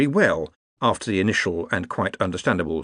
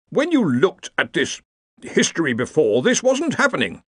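high fussy Dean; 7 semitones higher than usual. Acted. Dean arguing with the computer.

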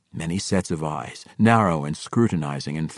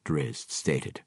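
ordinary delivery, nothing marked

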